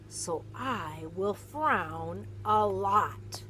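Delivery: lively, expressive picture-book read-aloud; animated storybook narration; reading to children. Acted. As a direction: read it like storytime for a little one.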